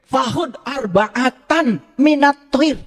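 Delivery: slow, chanted Arabic recitation; Quranic recitation. Shown as a demonstration. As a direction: draw out each Arabic word slowly. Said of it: "saya bacakan bahasa Arabnya".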